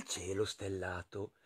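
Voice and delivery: soft hushed storyteller; sottovoce incantato. A narrator's reading. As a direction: let the word fade almost to a whisper. Hushed story wonder at word. (sottovoce, quasi sussurrato)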